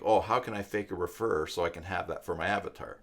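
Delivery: ordinary delivery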